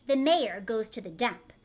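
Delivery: ordinary delivery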